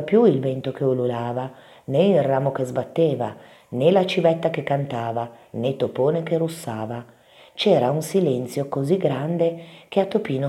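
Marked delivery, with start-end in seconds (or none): none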